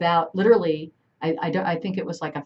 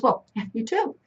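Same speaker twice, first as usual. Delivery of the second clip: mom fires it back, higher; mom's comeback; 6 semitones higher than usual. Retold or imitated. Mom snapping back at the prankster in mock retort. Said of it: "my mom just looks up and goes".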